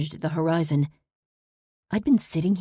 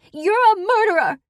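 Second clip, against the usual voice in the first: much higher, loud scream; screaming accusation; 9 dB louder than usual. Acted. Daughter screams at the parolee, high furious scream. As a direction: scream it with eyes bulging. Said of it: "she screamed".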